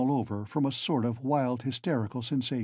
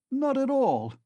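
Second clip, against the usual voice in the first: sharp, high flustered denial; embarrassed cry; 13 semitones higher, 4 dB louder than usual. Acted. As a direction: blurt it out, caught off guard. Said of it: "cried Zametov, obviously embarrassed".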